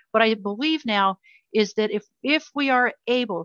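ordinary delivery

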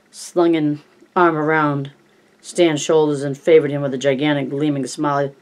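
ordinary delivery

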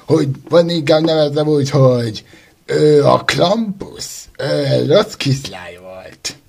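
ordinary talk, nothing marked

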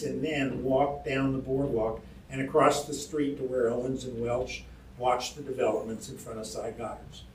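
ordinary delivery

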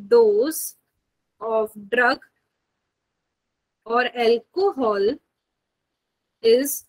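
slow, spaced-out dictation with long pauses. Acted. Teacher dictating a definition to her students, slow and spaced. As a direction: spell the definition out word by word for note-taking.

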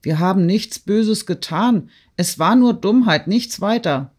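high, loud pleading excuses; pleading protest. A narrator's reading. Peasants plead with Rostow, high and urgent. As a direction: beg forgiveness in a rush.

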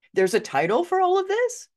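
high, rising disbelief; amazed squeak of a question; incredulous question. Retold or imitated. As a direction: blurt it out, astonished, climbing high. Startled reaction to the professional's news.

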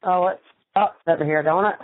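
ordinary talk

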